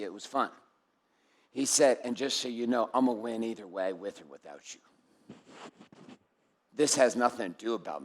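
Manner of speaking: quiet grave warning; hushed intensity. Acted. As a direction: pull the room in with a near hush. Sudden hushed intense delivery.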